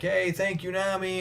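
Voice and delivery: high, happy farewell shout; cheerful call. Retold or imitated. Villagers cheering Nami off from the dock, pitched high.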